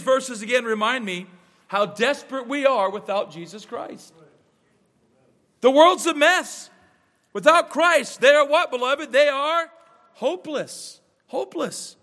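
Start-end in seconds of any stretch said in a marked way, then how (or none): 5.6-12.0 high, loud pulpit declaration; preacher at full force, sinking to a hush; fiery preaching. Acted. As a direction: drive it home to the congregation.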